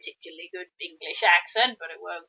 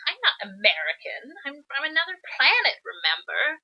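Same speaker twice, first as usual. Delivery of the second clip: lightly voiced princess, pitch jumping up on the protest; a hint of a princess objecting; indignant princess; 6 semitones higher than usual. Princess objecting to the narrator's remark, lightly acted indignation. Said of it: "the princess goes".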